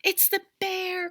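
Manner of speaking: put-on high frightened cry of a small boy. A narrator's reading. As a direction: Eddie cries out on hearing the bear, high and scared. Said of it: "cried Eddie".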